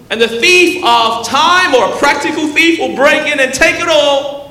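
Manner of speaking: high, loud, driving sermon delivery; full-force pulpit exhortation; impassioned preaching. Real, not acted. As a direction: pile up each charge with fervor.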